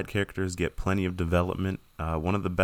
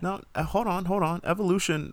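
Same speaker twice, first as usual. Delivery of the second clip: much higher, sudden objection; mock protest; 9 semitones higher than usual. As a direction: jump in high to protest. Mock objection defending a bad movie.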